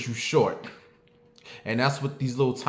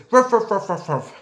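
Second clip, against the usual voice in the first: high barking ruff ruff; yappy dog imitation; 9 semitones higher, 5 dB louder than usual. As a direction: yap at the neighbour like the dog.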